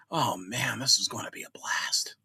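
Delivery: hushed, eager whisper; excited whisper. Acted. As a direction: whisper it with giddy anticipation. Excited inner thought whispered.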